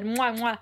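two quick smacking kisses; double air kiss. Real, not acted. Playful kisses after praise.

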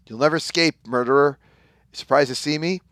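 a touch of hammed-up hero fury; heroic threat. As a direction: corner the murderer. Hero confronting murderer.

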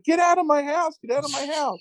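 very high imitated woman's shriek; mock frantic yelling. Host as woman throwing man out, mock frantic yell.

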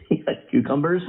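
laughing while asking. Real, not acted. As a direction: giggle through the question. Laughing at own joke.

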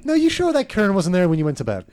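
high, loud hammed-up Irish reply; mock Irish accent. [name] plays along, hammed-up Irish accent. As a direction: answer like a proud Irish camper. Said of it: "in an Irish accent".

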